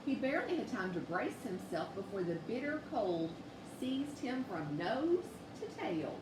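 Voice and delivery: plain reading voice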